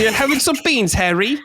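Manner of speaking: high hammed-up British voice; mock British accent. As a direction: echo the beans line in a silly English accent. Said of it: "Just think Harry Potter".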